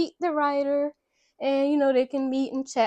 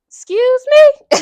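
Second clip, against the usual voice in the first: high, loud, slow Excuse me?; hammed-up indignant shout; mock outrage; 12 semitones higher, 12 dB louder, slower than usual. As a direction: blast it out in fake shock. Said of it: "So he ain't say it like that".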